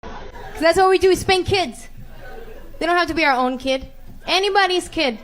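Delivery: put-on comic scolding a kid who talks back, loudly; loud rant. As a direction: scold a stranger's kid with full force. Ranting about spanking kids.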